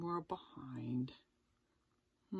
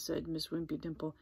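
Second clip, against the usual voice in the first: ordinary delivery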